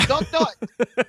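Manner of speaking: high, loud mock plea. Voicing Michael at paparazzi.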